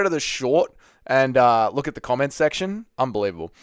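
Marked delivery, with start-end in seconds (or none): none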